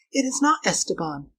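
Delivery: high, tense correction; urgent hiss. A narrator's reading. Girl correcting her companion in fear, urgent. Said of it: "hissed the girl".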